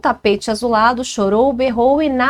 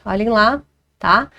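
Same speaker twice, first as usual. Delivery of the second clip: ordinary talk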